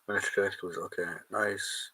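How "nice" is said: ordinary talk, nothing marked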